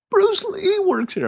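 very high, drawn-out disbelief; slow, squeaky mock-starstruck announcement; excited amazement. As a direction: re-enact gushing over a famous name. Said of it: "That's how I felt when I pointed it out".

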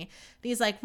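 usual speaking voice

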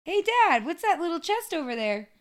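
put-on high, perky kid voice; curious little kid piping up; innocent child. Child asking Dad about a chest, high voice.

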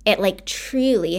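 ordinary talk

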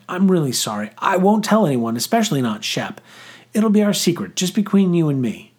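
high-pitched, pleading reassurance; earnest apology. A narrator's reading. Boy promising the girl secrecy, earnest apology.

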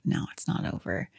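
priest's wordless reply, whispered; silent answer. Retold or imitated. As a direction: breathe it almost silently. Silent head shake voiced.

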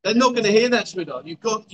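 ordinary talk, nothing marked

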